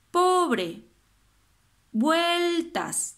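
"Pobre" y "vueltas" más altos, tónica marcada; énfasis en la rima. Shown as a demonstration. (profesora resalta palabras para la rima)

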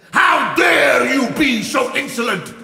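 high, slow outraged villain shout; angry shout. Acted. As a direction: roar with indignation, stretching the words. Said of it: "shouted Abanazar".

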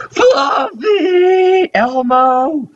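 high, drawn-out cry of names; mock desperate calling. Calling after Fluffy who falls, mock desperate cry. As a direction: call out in pretend despair.

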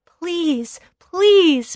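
high, pleading please. Acted. Boy pleading with the villagers, high and urgent. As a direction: beg them, high. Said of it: "pleaded the boy".

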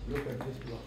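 ordinary talk, nothing marked

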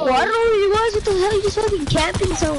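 high-pitched exasperated ranting; hyped gamer bro. Real, not acted. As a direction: rant at the camper, keyed up. Frustrated game outburst.

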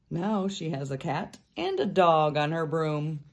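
ordinary delivery

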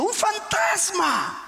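high, drawn-out cry of alarm; stretched, acted shout of fright; exclamation. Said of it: "Está con signo de admiración".

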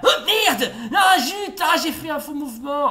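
high, loud yelps of frustration; exasperated outburst. Real, not acted. Player made a false move, exasperated outburst. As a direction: shout it high, cursing your own slip. Mistake in the game.

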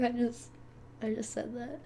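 usual speaking voice

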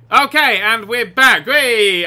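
boisterous show-opening shout; upbeat comeback. Real, not acted. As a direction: hail the return with a cheer. Returns after game crash.

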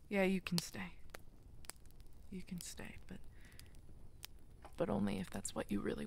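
soft, relieved giving in; tender whisper. Acted. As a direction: let them stay, gently. Tender acceptance after laughing.

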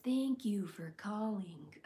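slow, slurred robot voice; slurring automated phone voice; drunk Alexa. Retold or imitated. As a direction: do a tipsy Alexa greeting. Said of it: "I did drunk Alexa".

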